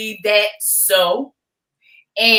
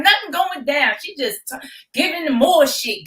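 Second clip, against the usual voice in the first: ordinary delivery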